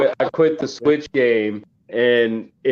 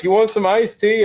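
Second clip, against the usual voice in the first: sweet neighbor lady; 9 semitones higher than usual. Retold or imitated. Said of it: "she's like".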